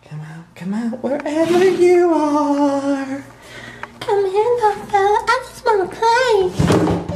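very high, slow, teasing villain voice; creepy singsong taunt. Acted. Taunting hiding fly.

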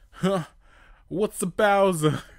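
high, loud baffled question; genuinely lost; confused Sonic. Acted.

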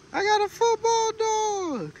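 very high, slow, loud delight; squeaky praising a dog. Real, not acted.

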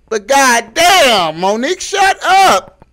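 slow, drawn-out loud outburst; exasperated shout. Acted. Ranter sick of Monique's feuds, exasperated shout. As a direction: stretch the words out, high and loud.

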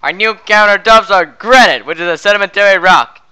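loud salesman pitch; shouting. Acted. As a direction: shout every word like an infomercial. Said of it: "why are you shouting everything".

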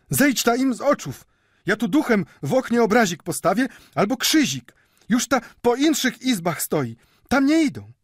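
caretaker woman's high, loud urging; urgent shouting. A narrator's reading. Caretaker woman urges old man out of sight, urgent shouting. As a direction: cry out orders at high pitch. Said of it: "krzyknęła od progu stróżka".

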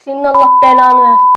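loud, fast angry curse. Real, not acted. Reacting to a woman eating on video, loud and angry.

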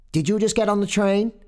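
high, fast, loud questioning; motorman's companion. A narrator's reading.

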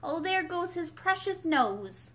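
put-on Alice watching a saucepan fly at the baby; alarmed cry. A narrator's reading. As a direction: gasp it out in horror. Alice frightened.

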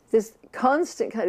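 usual speaking voice

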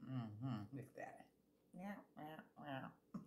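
hushed aside to the camera; whispering. Real, not acted. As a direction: breathe it out in a whisper. Quiet aside while eating.

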